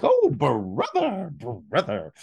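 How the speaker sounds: high, slow, drawn-out Oh brother; mock-jolly greeting to a moping kid brother. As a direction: stretch out the Oh like a cheerful big brother. Brother bounds in, greets him.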